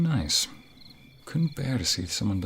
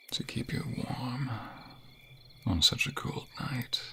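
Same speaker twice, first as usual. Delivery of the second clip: soft airy whisper; tender whisper; 3 semitones higher, 3 dB quieter than usual. Acted. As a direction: hold her close and whisper. Embracing lover.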